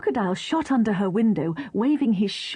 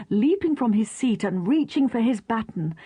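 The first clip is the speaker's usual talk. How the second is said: ordinary delivery, nothing marked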